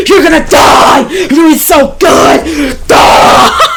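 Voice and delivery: high, loud yelling; excited shout. Real, not acted. Reviewer overwhelmed by the loud chorus, excited yelling. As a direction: scream it out, overwhelmed by the music.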